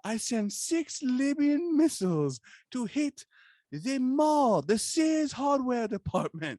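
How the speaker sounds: high, slow put-on foreign dictator voice; Muammar Gaddafi prank voice. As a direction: ham up a menacing prank-call dictator. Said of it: "So I call this dummy and I'm like".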